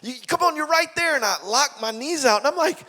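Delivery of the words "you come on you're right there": high, urgent coaching; pushing coach. Retold or imitated. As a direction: drive the player to finish.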